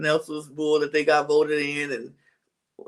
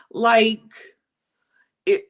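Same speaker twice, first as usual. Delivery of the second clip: usual speaking voice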